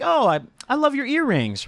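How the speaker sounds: high-pitched, slightly put-on gush; high mock admiration; gushing compliment. Acting out his compliment to waitress.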